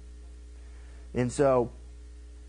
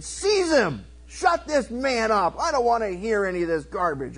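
high, emphatic acted order; commanding outburst; 3 semitones higher, 3 dB louder than usual. King ordering his men against the prophet, commanding outburst. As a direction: call out the order like an outraged king.

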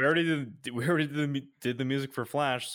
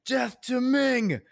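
high, loud, drawn-out battle cry; reenacted fan shout; 14 semitones higher, 5 dB louder, much slower than usual. Fan hailing Sam Jones at a convention, long high shout. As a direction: call it out slowly across a crowd. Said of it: "just shouted at him".

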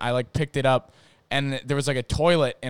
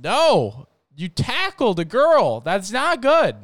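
higher, louder, slower telling-off; mock scolding; 8 semitones higher, 6 dB louder, slower than usual. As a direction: scold him loudly and deliberately. Friends scold the tackler.

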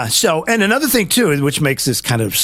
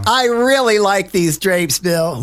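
mock Hillary; 4 semitones higher, slower than usual.

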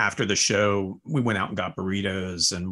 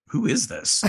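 higher-pitched, amused mock bafflement; baffled disbelief; 8 semitones higher, 4 dB louder than usual. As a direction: retell it as a laughing, puzzled reaction. Retold baffled reaction to the record.

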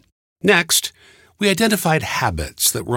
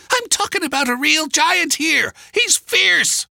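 put-on high, insistent old-woman voice; old woman's warning. A narrator's reading. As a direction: warn urgently in a high voice. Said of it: "the old woman warned".

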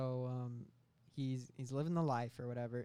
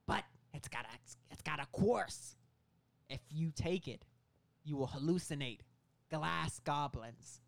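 Willem Dafoe. Acted. Meth dealer warning of side effects, Willem Dafoe impression. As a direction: draw out the warning theatrically. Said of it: "Willem Dafoe goes on to explain".

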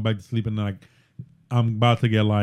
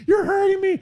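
imitating daughter. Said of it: "she'd be like".

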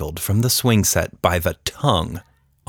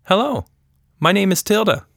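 put-on higher, friendly little-girl voice; young girl Tilda; 11 semitones higher, faster than usual. A narrator's reading. Tilda introducing herself to the shy creature, friendly girl voice. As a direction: greet the creature like a curious kid.

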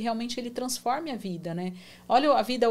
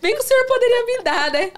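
high, louder, laughing through the prayer; amused, giggly retold prayer; laughing plea; 6 semitones higher, 6 dB louder than usual. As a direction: plead with God while cracking up. Laughs retelling her silly prayer.

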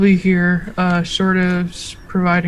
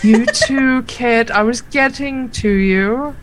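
playful sister; 5 semitones higher, slower than usual. Acted. GM voices sister reassuring Kit.

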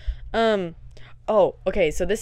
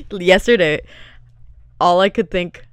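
normal talking voice

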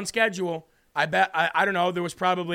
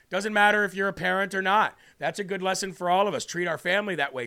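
normal talking voice

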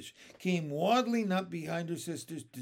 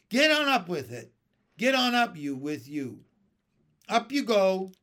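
louder, higher nagging; bossy order; 3 semitones higher, 7 dB louder than usual. Acted. Aunt ordering James up the tree, loud and bossy. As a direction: push him up the tree.

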